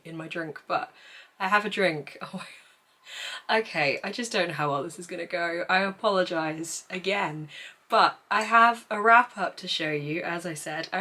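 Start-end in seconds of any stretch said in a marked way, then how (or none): none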